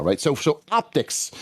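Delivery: normal talking voice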